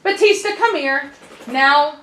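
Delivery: loud, sharp command to dog; stern shout. Real, not acted. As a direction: call the dog in firmly. Calling the dog over sharply.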